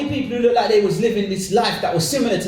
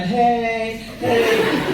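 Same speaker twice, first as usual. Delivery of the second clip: higher, held-out hey; 4 semitones higher than usual. Acted. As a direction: imitate girls calling from the back seat. Voicing girls in a music video.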